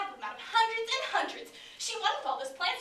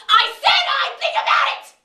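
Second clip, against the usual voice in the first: furious, much higher and louder shout; angry shout; 10 semitones higher, 14 dB louder than usual. Acted. Mother yells at the principal on the phone, furious shout. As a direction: yell it down the phone, fed up.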